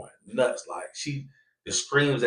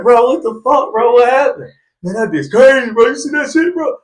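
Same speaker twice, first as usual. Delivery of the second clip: excited retelling; 13 semitones higher, 12 dB louder than usual. Retold or imitated. Excited retold exchange, loud high.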